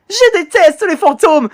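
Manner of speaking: high-pitched acted terror; panicked victim. Victim who hates ghosts facing one, high panicked cry.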